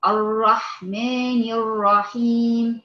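slow, unhurried Quran recitation, verse by verse; slow measured recitation. Shown as a demonstration. Teacher showing students slow careful recitation. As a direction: recite calmly, one verse at a time. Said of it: "هي بتقرأ على مهلها، آية، آية".

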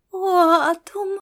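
slow, high, swooning delight; blissful wonder. A narrator's reading. Star cat sniffing her favourite treat, blissful wonder. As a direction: sigh it out, overcome by the smell.

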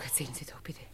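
eager pleading whisper; breathless whispered plea. Acted. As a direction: beg for the secret in a whisper. Begging to hear a secret.

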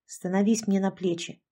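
plain reading voice

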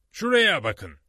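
high, loud burst of discovery; excited. A narrator's reading. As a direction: call them over eagerly to see. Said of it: "dedi heyecanla".

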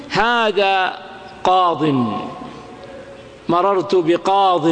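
قاض ending in "-in", as "qaadin", no long ii; slow قاض and بقاض with a held final n; dropped ya. Shown as a demonstration. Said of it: "فيا المنقوص يقول تحذف". (نطق قاض وبقاض بالتنوين وحذف الياء)

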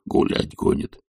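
hoarse low murmur; baffled servant's undertone; puzzled thought. A narrator's reading. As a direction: muse softly to yourself. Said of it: "думал Захар".

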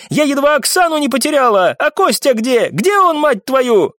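very high-pitched enraged tirade; sustained angry outburst, voice way up; furious shouting. A narrator's reading. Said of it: "прокричала баронесса с яростью".

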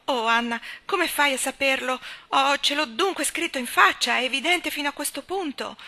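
high, loud, agitated outburst; vehement exclamation. A narrator's reading. Leslie learns her secret shows on her face, vehemently dismayed. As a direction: cry out in distress at being found out. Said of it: "esclamò".

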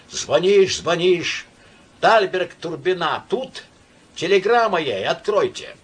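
high, urgent pleading stranger; pleading voice behind door. A narrator's reading. Stranger behind the door begs Myshlaevsky to open, pleading. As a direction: beg to be let in, impatient. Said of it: "Приглушенный голос за дверью взмолился".

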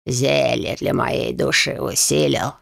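low, slow sinister rasp; sinister wheeze. A narrator's reading. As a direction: wheeze it out ominously. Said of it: "другим каким-то зловещим голосом прохрипел".

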